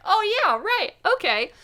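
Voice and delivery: high, loud oh yeah; sheepish realization. Retold or imitated. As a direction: caught out and quickly agreeing. Caught breathing wrong by nurse.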